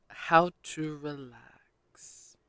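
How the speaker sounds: slow whisper while typing; whispered search. Real, not acted. Typing a search query, whispered slowly. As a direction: whisper the words as you type them. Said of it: "Let's Google it".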